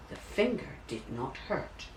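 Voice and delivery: ordinary delivery, nothing marked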